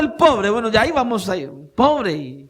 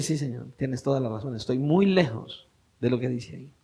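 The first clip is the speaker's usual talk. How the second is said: low, very soft retold admission; humbled confession; 8 semitones lower, 9 dB quieter than usual. Preacher retells answering the Lord after self-examination, hushed and humbled. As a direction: quietly concede it, voice dropped low.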